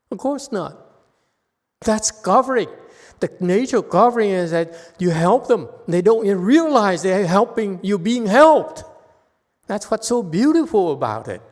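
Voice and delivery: getting excited. Real, not acted. Teacher carried away praising the beauty of covering, excited. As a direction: voice climbing high and loud with enthusiasm. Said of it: "I'm getting excited".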